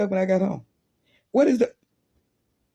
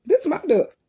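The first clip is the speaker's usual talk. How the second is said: tag; 9 semitones higher than usual. Retold or imitated. Child protesting to bus driver.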